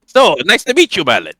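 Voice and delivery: put-on booming, high Russian-accented character voice; stout foreign newcomer. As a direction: greet strangers in a high-pitched Slavic accent. [name] greets Merlin in character.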